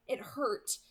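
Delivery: plain reading voice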